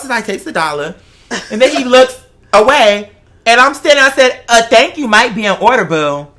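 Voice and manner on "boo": normal talking voice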